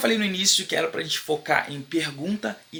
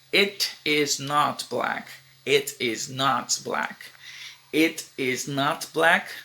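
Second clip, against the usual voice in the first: very slow, each word set apart; slow model sentence; much slower than usual. Shown as a demonstration. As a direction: spell it out for the class word by word. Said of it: "teacher models sentence slowly".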